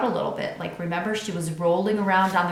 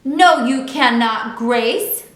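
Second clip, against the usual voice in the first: yelled back; 5 semitones higher, 8 dB louder, slower than usual. A narrator's reading. Girls' quarrel.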